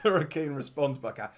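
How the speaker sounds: ordinary talk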